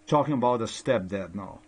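normal talking voice

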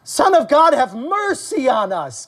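high drawn-out plea, falling at the end; crying out. Acted. Preacher voicing demons pleading with the Son of God, crying out. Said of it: "the demons cry out".

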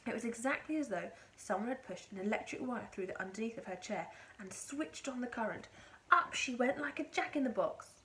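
ordinary delivery

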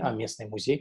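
ordinary delivery

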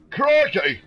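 loud, high exclamation; hammy Aussie accent. Acted. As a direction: belt out a hammy Crikey. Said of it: "I just went full cockney there".

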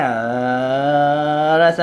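low chest vocalise on ờ; chest voice demo. Shown as a demonstration. Vocal coach showing the chest-voice start. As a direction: sing low, sending air back. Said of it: "mình sẽ đi vòng ngực nha".

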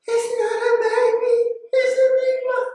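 very high squealed call; shrieked retold protest. Diver arguing with friends about the shark, high squealed protest. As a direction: insist at the very top of your pitch.

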